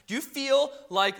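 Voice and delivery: eager sales-pitch voice; infomercial voice. Pretend. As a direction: sell it like a TV ad. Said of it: "it sounds like an infomercial".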